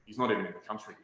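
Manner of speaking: ordinary delivery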